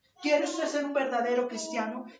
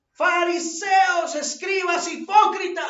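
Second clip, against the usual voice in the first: slow, loud and much higher accusing shout; shouting rebuke; 6 semitones higher, 6 dB louder, slower than usual. Acted. Preacher voicing Jesus rebuking the Pharisees, shouting. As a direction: hurl each name at them.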